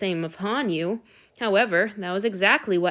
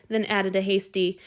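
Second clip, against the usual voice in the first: ordinary delivery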